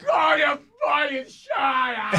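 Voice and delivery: drawn-out, high-pitched mock rage; imitating his own enraged shriek; retold furious scream. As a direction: scream it hysterically, words stretched out. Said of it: "I was so mad at them".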